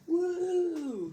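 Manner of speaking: long, high, loud woo; drawn-out hype whoop; excited whoop. Real, not acted. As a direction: whoop it up for the crowd. Hyping up the video intro.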